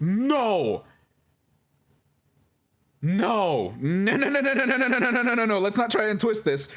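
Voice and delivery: high, insistent run of no; real alarm at the accusation; urgent denial. Real, not acted. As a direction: cut the claim off fast with a string of no. Denying a twisted claim.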